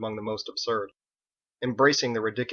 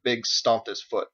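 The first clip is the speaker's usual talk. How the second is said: ordinary delivery, nothing marked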